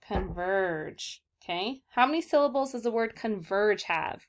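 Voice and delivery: ordinary talk, nothing marked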